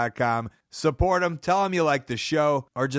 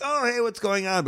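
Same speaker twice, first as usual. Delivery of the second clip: mock casual phone greeting, pitched up; fake phone chat; 7 semitones higher than usual. Friend mimicking a fake phone call while peeing, pitched up breezy. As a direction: act out a pretend phone call. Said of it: "pretending talking on the cell phone".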